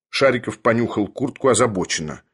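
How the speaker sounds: ordinary delivery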